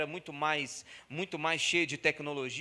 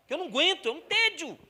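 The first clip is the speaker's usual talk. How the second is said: mais agudo e alto, exasperado; 10 semitones higher, 4 dB louder than usual. Real, not acted. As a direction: solta o desabafo bem alto. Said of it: "pregador exclama seu tédio". (desabafo exasperado e alto)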